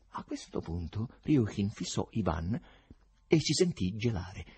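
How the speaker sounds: ordinary delivery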